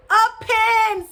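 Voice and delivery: loud, high, drawn-out "A pen"; exasperated father. A narrator's reading. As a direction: shout the answer in exasperation. Father shouts the word back.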